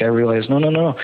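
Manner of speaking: ordinary talk, nothing marked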